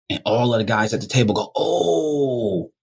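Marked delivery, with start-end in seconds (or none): 1.5-2.7 shocked group oh. Retold or imitated. Table reacts in shock.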